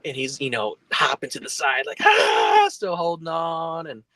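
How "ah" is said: high, loud yelp of alarm; imitated scream. Imitating friend hopping away from danger, high mock scream. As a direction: mimic a panicked friend's scream. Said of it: "he starts screaming bloody mur-, murder".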